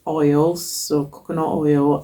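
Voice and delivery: normal talking voice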